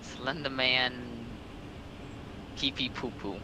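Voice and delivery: ordinary talk, nothing marked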